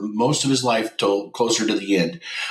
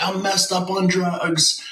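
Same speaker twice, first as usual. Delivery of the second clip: high, slow, pleading confession; retelling a desperate confession, pitched high; frantic blurt; 8 semitones higher, slower than usual. Retold or imitated. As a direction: blurt it out to his wife in a panic. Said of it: "I was like".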